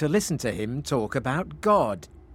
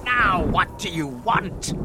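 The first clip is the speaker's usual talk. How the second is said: slow, raised, irritated demand; angry shout; 5 semitones higher, slower than usual. A narrator's reading. Magic fish confronting Frederick, angry slow shout. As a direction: demand it crossly, stretching the words. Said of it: "shouted the magic fish angrily".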